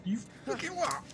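high mock disbelief; incredulous. Real, not acted. Incredulous reaction.

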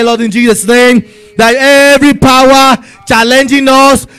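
rising, urgent prayer, pressing each line; fervent prayer. Acted. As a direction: pray it out with full force to God. Fervent prayer leading congregation.

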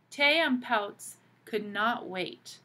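ordinary delivery

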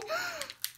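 surprised gasp. Real, not acted. Surprised by the little toy.

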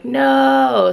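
a quick yelled refusal at the sight; startled no. Retold or imitated. Finding ants in the dog's food, retold loud no. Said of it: "and I was like".

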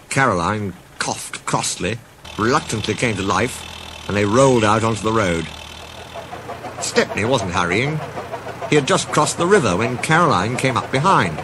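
ordinary delivery

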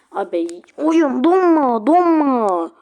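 high, loud, drawn-out plea; desperate yell. Real, not acted. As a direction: scream at the frozen game. Game freezing again, yelling. (donan oyuna yüksek sesle yalvaran yayıncı)

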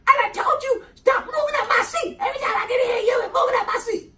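very high, shouting crying mock woman; Rasputia voice. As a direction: wail and shout in a huge put-on voice. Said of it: "she said".

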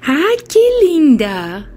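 lilting pet talk on Ai, que linda; high cooing pet voice. Real, not acted. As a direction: swoop up on Ai, coo que linda.

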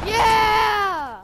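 loud victory cheer; triumphant shout. Real, not acted. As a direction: celebrate the kill. Excited game reaction.

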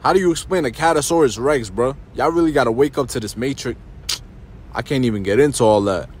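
ordinary talk

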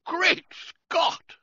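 high, loud cry of surprise; startled cry. A narrator's reading. Reggie startled to see George at the gangway, high loud cry. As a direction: jump at the sight and blurt it out. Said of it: "I cried".

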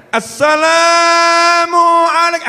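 high, long-drawn yelled chant; shouted salawat call. Shown as a demonstration. Preacher urging the women to call the Prophet, shouting. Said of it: "Bu, teriak".

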